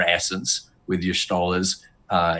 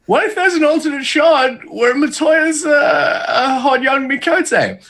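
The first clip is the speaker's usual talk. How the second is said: excited mock pitch; 4 dB louder than usual. Co-host pitches a wilder alternate shard theory, high and over-excited. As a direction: pitch an absurd theory at a squeal.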